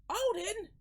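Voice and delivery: high, questioning call; worried girl character. Acted. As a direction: call the name high and anxious. Girl calls out to Odin.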